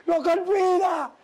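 high, drawn-out refusal as the gringo; mock high boss voice; imitated gringo. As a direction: stretch it slowly, pitched well up.

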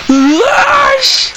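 high shocked yelp then cry; startled scream. Real, not acted. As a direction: scream from a real fright. Jump scare by the witch.

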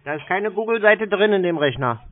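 mock naive. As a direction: act the clueless caller, fully earnest.

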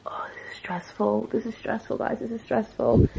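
stressed, hushed high fretting. Real, not acted. Vlogger stressed over packing for Ibiza, fretting softly. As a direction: fret softly under pressure.

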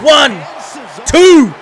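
wrestling commentator. Acted. Calls the match live.

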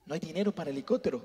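low, quick, weary mother's reply; tired mother. Retold or imitated. Mother telling her son there's no money, low and quick. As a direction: brush it off like a broke, tired mom. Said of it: "mother answers".

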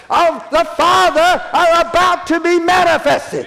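preaching climax. Acted.

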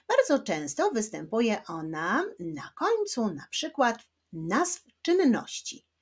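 normal talking voice

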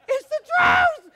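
high, loud, drawn-out cry; shouted truth. Real, not acted. Preacher shouting the truth at a laughing congregation.

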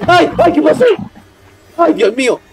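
high, startled cries; frightened, pitch leaping up; panicked yelping. Real, not acted. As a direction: yelp in sudden panic. Enemy appears suddenly.

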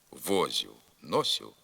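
возю, носю stressed on the first syllable; southwestern dialect forms. Shown as a demonstration. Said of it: "а також відоме в деяких південно-західних".

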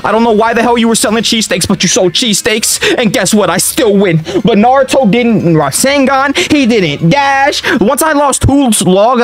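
incredulous gamer rant; outraged yelling. Real, not acted. Yelling at character during game.

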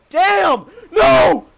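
high, loud "Damn!" then "No!"; real gamer rage shout; frustrated yell. Real, not acted. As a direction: scream it as you die to the boss. Boss kills him, yelling.

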